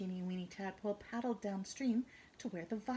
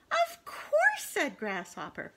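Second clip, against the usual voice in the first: high grasshopper voice; 12 semitones higher, 8 dB louder, slower than usual. Acted. Grasshopper boasts.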